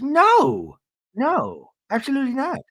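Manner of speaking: high mock indignation; Meredith, refusing. Meredith refusing to let Seth leave, high indignation. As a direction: imitate a wife flatly refusing, pitched right up. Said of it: "and Meredith goes".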